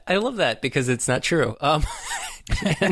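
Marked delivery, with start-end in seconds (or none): 1.9-2.7 a sudden high-pitched squeal of laughter. Real, not acted. Laughing at the movie scene.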